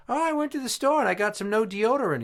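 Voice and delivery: put-on high-pitched old lady voice; put-on high old woman's voice. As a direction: chirp it high like an old lady reporting her errand. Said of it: "And she goes".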